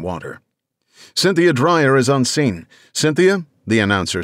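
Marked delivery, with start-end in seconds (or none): 1.1-2.7 higher, louder news anchor; radio announcer. Acted. Announcer hands off to reporter. 2.9-3.5 high rising call to reporter; radio announcer. Acted.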